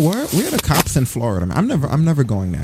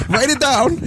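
laughing high voice; 11 semitones higher, slower than usual. Pretend. Laughing while mocking.

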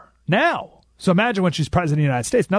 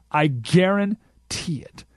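slow, deliberate guarantee; much slower than usual. Real, not acted. Host slowly stressing guarantee.

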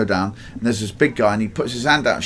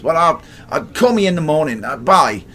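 higher, hurried brush-off voice; dismissive brush-off; 6 semitones higher, 4 dB louder than usual. Retold or imitated. Busy friend brushing off the newcomer, hurried. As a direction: wave the caller off and hang up. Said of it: "He goes".

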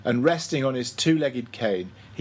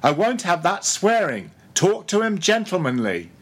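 stern rebuke; 6 semitones higher, 4 dB louder than usual. A narrator's reading. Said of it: "cried Stubb".

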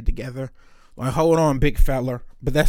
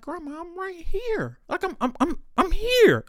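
whiny high plea; 13 semitones higher than usual. Retold or imitated. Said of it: "you'd be like".

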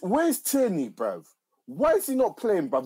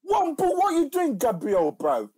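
high, loud outburst at the screen; frustrated yell; 7 semitones higher, 4 dB louder than usual. Real, not acted. Fan yelling at Arsenal's defender, loud and high. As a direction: yell at Gabriel for giving the goal away.

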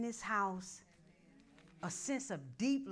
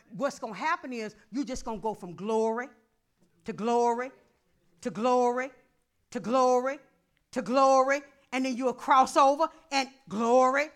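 louder and louder on each glory; building preaching; 3 semitones higher, 12 dB louder than usual. Acted. Pastor building the church to a climax, from strong to loud. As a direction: climb with every glory.

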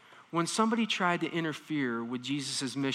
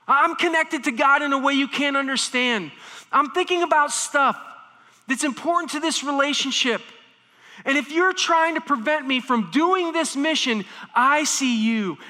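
louder, higher stern rebuke; intense rebuke; 8 semitones higher, 5 dB louder than usual. Retold or imitated. Jesus rebuking Peter, loud and stern. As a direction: correct him forcefully. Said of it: "the same intensity".

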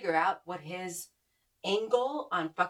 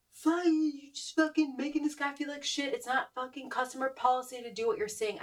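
retold mock yell; 6 semitones higher than usual. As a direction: imitate an outraged customer yelling, pitched well up. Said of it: "just screamed at him".

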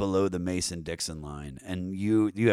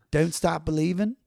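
higher, broad Maryland O's; Baltimore accent; 6 semitones higher, 4 dB louder than usual. Shown as a demonstration. Musician demonstrating his rolled O's, Baltimore accent. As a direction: ask it in a thick Baltimore twang. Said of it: "roll my O's".

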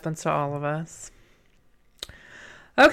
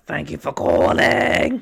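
slow, croaky smoker, drawn out; Edith; 4 dB louder, slower than usual. Acted. Repeating the phone greeting for laughs, exaggerated smoker voice. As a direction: stretch it out in a rough smoker's croak. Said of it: "Edith character is a very heavy smoker".

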